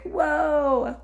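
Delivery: high, drawn-out whoa of wonder; awed whoa. Retold or imitated. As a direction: let the whoa float up, amazed. Awed reaction to people's interest.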